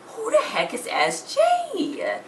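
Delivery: high, slow, baffled voice; high puzzled squeak. Retold or imitated. Girl finds unknown initials in her mother's diary, high slow squeak. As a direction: stretch it out in a high squeak of confusion.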